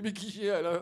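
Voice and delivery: put-on exasperated boy turning s into sh; speech defect. Little boy asking his classmate about Alain, lisped exasperation. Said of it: "il parle comme ça".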